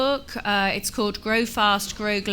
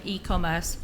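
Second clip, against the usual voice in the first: ordinary delivery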